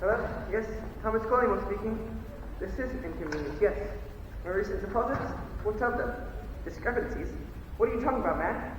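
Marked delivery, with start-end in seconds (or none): none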